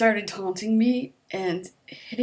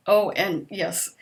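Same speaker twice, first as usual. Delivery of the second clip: ordinary delivery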